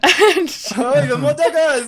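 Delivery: high, loud cry in a mock-French accent; Francois. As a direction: exclaim shrilly in a bad French accent. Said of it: "This is a Francois accent".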